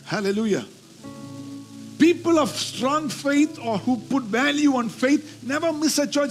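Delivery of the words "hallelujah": ordinary talk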